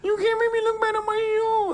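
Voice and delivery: very high, fake-crying whine; mock a host whimpering; hammed-up crybaby wail; whiny crybaby. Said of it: "That's what I hear".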